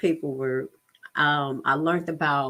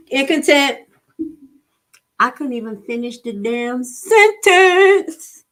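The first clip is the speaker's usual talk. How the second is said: higher, louder, slow and outraged; incredulous louder rant; indignant outburst; 7 semitones higher, 8 dB louder, slower than usual. Retold or imitated. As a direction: slam the injustice home. Judge cuts her off.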